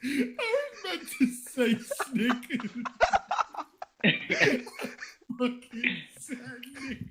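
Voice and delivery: high, helpless real laughter through the words; laughing hard. Real, not acted. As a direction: try to talk while cracking up. Cracks up over own slip.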